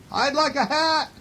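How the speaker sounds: very high echo voice; moon's echo. A narrator's reading. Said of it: "It's his voice echoing".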